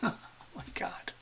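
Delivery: whispered through a real laugh; breathy laugh into a disbelieving whisper. Real, not acted. Host laughing to viewers at her over-the-top drawing, whispered.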